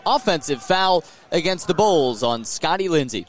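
ordinary talk, nothing marked

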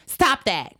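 sudden loud command. Retold or imitated. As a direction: imitate him sharply ordering you to stop. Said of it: "he raises his voice to me".

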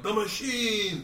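put-on high, long-drawn drunken-crowd cheer; cheering cry. Drinking buddies greet their returning hero, drawn-out cheer. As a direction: stretch it out like a chanted greeting. Crowd hails him in retold scene.

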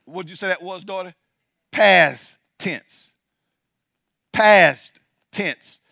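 loud, high, ringing preacher's cry, slowed on key words; full-force preaching. Acted. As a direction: preach it out to the whole church, building. Preacher reading scripture at full force.

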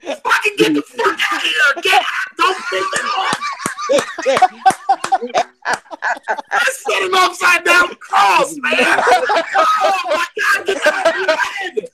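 high disbelieving laughing yells; laughing shouts. Real, not acted. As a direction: yell in shocked laughter. Shocked at tattoo on stream.

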